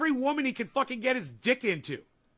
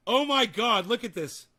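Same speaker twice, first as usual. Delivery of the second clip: normal talking voice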